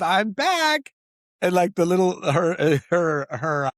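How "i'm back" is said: high, bright-voiced return line; mock grand entrance. Actress quoting the character walking back in, mock grand. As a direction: announce your comeback with flair. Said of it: "she goes".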